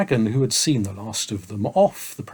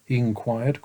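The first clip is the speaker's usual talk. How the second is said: ordinary delivery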